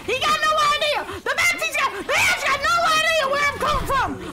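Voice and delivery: high, loud Southern whoop; Southern accent. Pretend. Player gloating over the unaware Ash, excited Southern accent. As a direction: crow it in a Southern twang, laughing. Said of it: "I don't know why I went southern".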